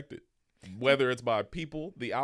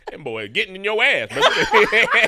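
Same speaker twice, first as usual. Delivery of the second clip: loud, higher rough-edged dad impression; imitating his dad; 9 semitones higher, 12 dB louder than usual. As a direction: do the late dad razzing his son, big and loud. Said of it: "you hopping in the voice so quick".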